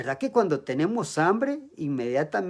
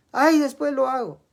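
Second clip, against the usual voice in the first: put-on whiny child; 7 semitones higher, 6 dB louder than usual. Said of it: "El niño le pide el premio".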